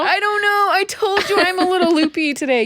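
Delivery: can't stop giggling while talking; giggling fit. Real, not acted. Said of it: "I don't know why I'm laughing so much".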